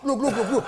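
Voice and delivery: turkey gobble glu glu. Pretend. (imitando peru)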